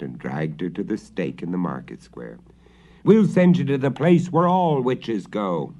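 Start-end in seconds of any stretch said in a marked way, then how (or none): 3.0-5.7 loud, higher mob shout. A narrator's reading. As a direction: jeer it at the witch. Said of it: "they shouted".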